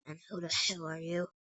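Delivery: low, quiet growly demand; deep growly monster. Acted. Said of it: "His voice is deep, deep, growly".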